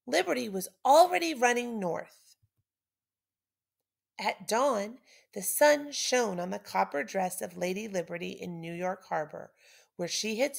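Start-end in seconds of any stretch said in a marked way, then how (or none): none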